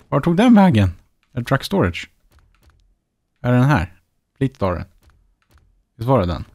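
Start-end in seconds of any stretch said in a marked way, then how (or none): 0.1-0.9 higher and louder, baffled exasperation; where on earth did it go?; exasperated. Real, not acted. Streamer can't find his sold truck, exasperated and louder.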